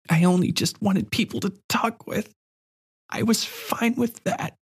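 put-on a lonely girl letting him go; sad little girl. A narrator's reading. Girl saying goodbye.

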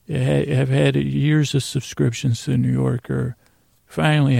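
ordinary talk, nothing marked

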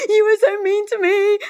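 high, loud, teary wail, imitating her past self; mock-sob the accusation, high and loud; hammed-up crying complaint to husband; tearful whine. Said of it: "And I'm just crying".

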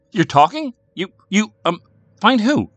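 high, loud, stammering astonishment; stunned. A narrator's reading. Boy hearing his silent sister talk, stunned. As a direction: stumble over it in shock.

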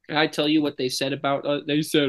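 ordinary delivery

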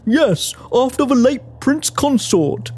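low, slow voice of an earnest man; earnest gentleman. Acted. A colleague explains his chimpanzee's name, earnest.

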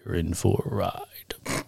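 low, slow creepy voice. Pretend. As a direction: say it like a spooky storyteller. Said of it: "That creepy voice did not work".

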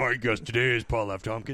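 low mock podcast-host voice; lightly put-on host announcement; Wolverine as host. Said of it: "I'm Wolverine, welcome to the show".

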